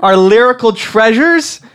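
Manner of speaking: loud, high-pitched impassioned defense; indignant outburst. Acted. Band fan rebuking a co-host, indignant and loud.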